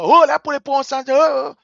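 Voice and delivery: loud mocking mimicry of foreign partners; mocking noisy partners. To listeners, mimicking noisy international partners loudly. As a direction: ham up their fuss. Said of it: "These people that were making noise".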